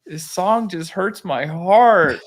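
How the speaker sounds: mock-heartbroken kid, high, loud and drawn out; hurt young son. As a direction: lightly imitate a wounded little boy, slowly. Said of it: "even a six-year-old".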